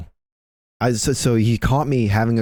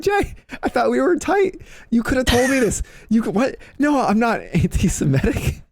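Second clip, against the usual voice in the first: high whiny mock heartbreak; mock hurt. Pleading with his teammate Jay that they were close, high mock hurt whine. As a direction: play wounded, pitched way up.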